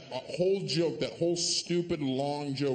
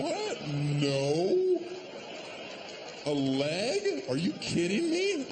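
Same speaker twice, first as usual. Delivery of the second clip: incredulous; 4 semitones higher, much slower than usual. Acted. Comic reacting to question.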